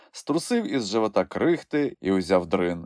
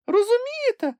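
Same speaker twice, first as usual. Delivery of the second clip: very high, squeaky little creature voice; a tiny animal piping up in excitement; Kulka exclaiming. A narrator's reading. Kulka tells Grampus they are almost there, squeaky excited cry.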